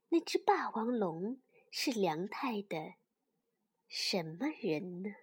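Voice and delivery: soft, low goodnight; a parent saying goodnight softly; bedtime story whisper. Real, not acted. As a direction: wind down for sleep. Bedtime close to child.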